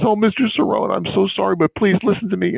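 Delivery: a lightly voiced, high-pitched anxious florist; high apologetic woman. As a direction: play the flustered flower woman begging to explain. Flower woman apologizing on phone.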